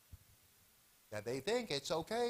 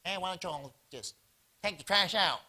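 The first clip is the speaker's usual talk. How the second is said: hillbilly dude. Retold or imitated. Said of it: "this hillbilly dude, is saying".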